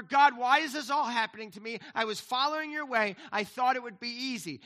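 a hint of whiny complaint, pitched a bit up; mock whine at God; mock whining complaint. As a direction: whine it like a tired believer. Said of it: "tendency in our flesh to whine and complain".

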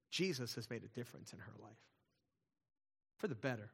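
usual speaking voice

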